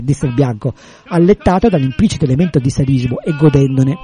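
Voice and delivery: plain reading voice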